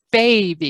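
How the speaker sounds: put-on "baby" with a loud "ba"; stress on first syllable. Shown as a demonstration. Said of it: "on the stress syllable".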